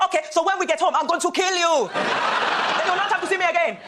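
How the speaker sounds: high, mock-menacing auntie voice; Nigerian mother's threat. Mother threatening her daughter on the bus, high mock menace. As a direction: threaten like an exasperated Nigerian mum. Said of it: "But the woman's reply was brilliant".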